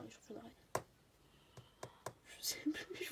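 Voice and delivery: whispering. Real, not acted. Whispered aside, forgot the name.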